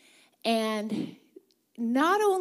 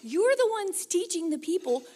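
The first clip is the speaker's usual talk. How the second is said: higher and louder, a mock rebuke of the Pharisees; Jesus rebuking; 7 semitones higher, 3 dB louder, slower than usual. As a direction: chide the teachers who should know better. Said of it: "He's like".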